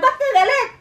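put-on high, indignant old lady's retort; offended old woman. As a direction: play a grandmother who thinks she's being yelled at. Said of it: "Eh, sasagot naman".

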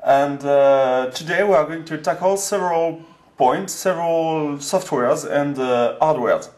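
put-on English spoken with a heavy French accent; a Frenchman gamely doing his intro in English. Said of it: "de mon accent anglais". (anglais avec un fort accent français)

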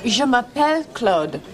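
"Je m'appelle Claude" said slowly and clearly; slow careful demo. Shown as a demonstration. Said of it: "let's try it again".

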